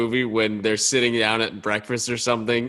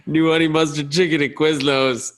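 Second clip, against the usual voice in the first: higher mock mascot pitch; Quiznos guy; 4 semitones higher, 3 dB louder than usual. Costumed Quiznos guy behind the protest, mock sales call. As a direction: call it out like a costumed sandwich promoter. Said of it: "Quizno guy ... just starts going".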